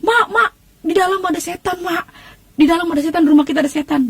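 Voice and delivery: high, panicked calling for mother; scared child yelling that there's a ghost; frantic yell to mom. Retold or imitated. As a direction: shout for mom in terror, pitched high. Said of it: "saya teriak".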